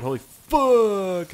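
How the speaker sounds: drawn out high startled yell; surprised shout. Real, not acted. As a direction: blurt it out, astonished. Sudden speed surprise.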